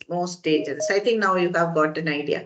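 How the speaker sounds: normal talking voice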